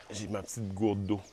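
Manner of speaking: normal talking voice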